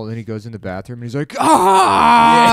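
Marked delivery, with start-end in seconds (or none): 1.4-2.5 loud, high, long yell; high-pitched drawn-out mock scream. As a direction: scream it out in one long burst. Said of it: "screams until his dick gets bigger".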